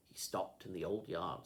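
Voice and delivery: usual speaking voice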